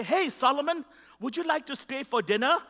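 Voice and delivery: lightly voiced neighbour mom offering dinner; sweet mom inviting. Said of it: "their moms would say".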